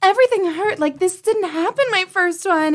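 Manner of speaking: high-pitched whiny retelling of a grievance; mock-plaintive patient; complaining. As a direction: complain to the midwife, pitched well up. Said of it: "I was, like, kind of complaining".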